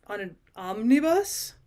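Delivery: slow OMNIBUS with a rising questioning end; incredulous echo. A narrator's reading. Cousin questioning the wife's answer, incredulous. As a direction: repeat it in disbelief, lifting OMNIBUS.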